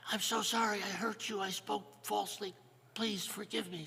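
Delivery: gently begging husband; contrite apology. Acted. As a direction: ask her forgiveness sincerely. First man apologizes to wife.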